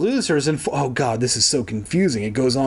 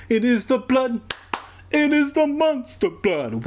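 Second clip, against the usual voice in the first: much higher, swooping on "the monster blood"; dramatic mock horror; 11 semitones higher than usual. Shown as a demonstration. Reader repeating the phrase for effect, high mock-horror squeal. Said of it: "repeated with huge pitch rise".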